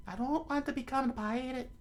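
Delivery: high falsetto refusal; high-pitched young woman character; Nami. Acted. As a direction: object in a put-on female voice. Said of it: "Nami said".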